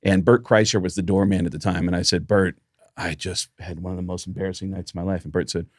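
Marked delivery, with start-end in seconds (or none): 2.3-5.2 soft, low embarrassed admission; dejected quiet. Retold or imitated. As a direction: confess it deflated.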